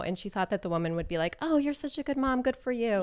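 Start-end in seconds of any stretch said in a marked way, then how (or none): 1.4-3.0 lightly voiced, higher-pitched praise; a hint of gushing approval; imagined approving praise. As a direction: mimic an approving mom, pitched up. Imagined praise from another mom.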